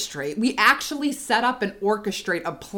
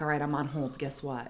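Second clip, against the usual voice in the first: soft, lower, casual setup; low-key bait; 7 semitones lower, 10 dB quieter than usual. Retold or imitated. Mom baiting Rich while pretending to hold, hushed. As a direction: slip the question in quietly.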